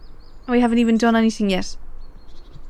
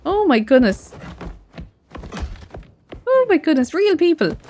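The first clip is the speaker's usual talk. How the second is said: high excited exclamation; 6 semitones higher than usual. Real, not acted. Player reacting to real people on screen, excited. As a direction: burst out with delight.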